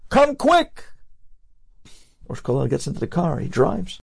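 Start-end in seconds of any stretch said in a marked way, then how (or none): 0.1-0.8 high, urgent, breathless summons; urgent call. Retold or imitated. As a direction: hurry him along, high and pressing. Student urging rabbi to hurry.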